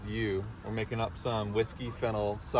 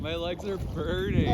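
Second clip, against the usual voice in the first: cold shock; 8 semitones higher than usual. Real, not acted. In icy lake water.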